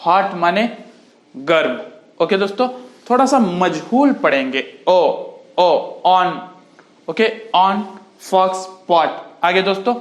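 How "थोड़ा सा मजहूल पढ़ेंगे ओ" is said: normal talking voice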